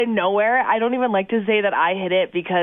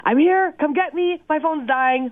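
urgent phone plea; 7 semitones higher than usual. Retold or imitated. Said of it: "quickly called my partner".

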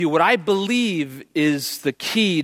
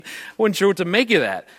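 usual speaking voice

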